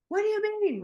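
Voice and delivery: high, loud acted indignation; escalating mock anger. Harry challenged by his friend over an old grudge, mock indignant. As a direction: shoot the pitch up, playing offended. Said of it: "He's gonna escalate".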